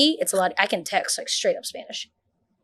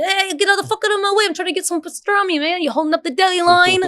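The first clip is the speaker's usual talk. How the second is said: put-on higher-pitched New York Guido accent, pushy; 10 semitones higher than usual. Imitating a New York Guido demanding pastrami, pushy accent. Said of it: "you got the Guidos".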